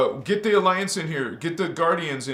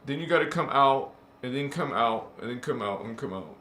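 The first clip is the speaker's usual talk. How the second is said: fading echo; 5 semitones lower, faster than usual. Shown as a demonstration. Describing endless worlds to exit, fading echo. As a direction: sink away like a voice going down a tunnel.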